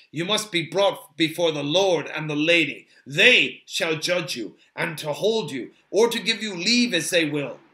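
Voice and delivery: understated elven sternness; stern Haldir. A narrator's reading. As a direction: lay down the law with authority. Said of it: "said Haldir sternly".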